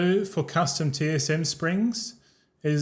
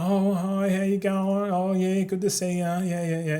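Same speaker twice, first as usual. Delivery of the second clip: higher, put-on social pleasantries; fake friendly mask; 3 semitones higher than usual. Introvert faking small talk with people, put-on friendliness. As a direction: play a falsely friendly greeting. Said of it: "gotta put on the mask".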